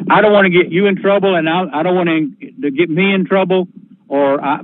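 plain reading voice

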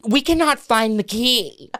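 drawn-out passive-aggressive agent; imitating a snooty agent, slow and high; nasty listing agent. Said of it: "They get so nasty".

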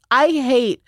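normal talking voice

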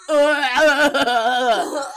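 mock grossed-out groan; a hammed-up grunt of disgust; disgusted ugh. Retelling film character's disgust.

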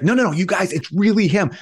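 insistent excitement. Retold or imitated. Insisting to unimpressed siblings.

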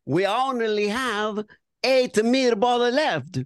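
hammed-up Italian waiter; Italian accent. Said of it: "Oh, now I'm gonna do Italian".

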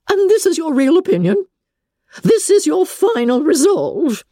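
high, sharp incredulous demand; Lady Catherine voice. A narrator's reading. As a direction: demand it in disbelief.

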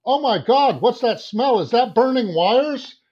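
high, loud alarmed cry over a strange smell; a shrill-free but loud, high-pitched panic; panicked passenger. Retold or imitated. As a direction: play a passenger startled and scared by a burning smell. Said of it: "somebody on the plane goes".

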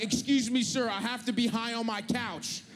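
high-pitched, urgent mock-polite plea; urgent mock politeness. Traveler rushing past the elderly, mock-urgent plea. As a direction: push past strangers with hammed-up high urgency. Acted plea while elbowing through airport.

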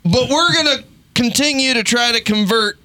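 lightly hammed-up stubborn American; stubborn mock insistence. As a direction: insist on it like a proud fool. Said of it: "we were like".

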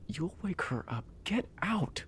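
whispered scolding, sharp; angry whisper. Acted. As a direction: whisper her out of the room. Leo whisper-scolding Ellie.

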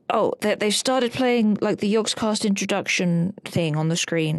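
usual speaking voice

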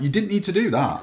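high, loud exasperated quip. Retold or imitated. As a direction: scold it with mock irritation. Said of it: "And I'm like".

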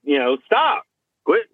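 high sharp sit and stop; firm stop commands. Shown as a demonstration. Trainer shows commanding the dog to stop. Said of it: "palms out, like".

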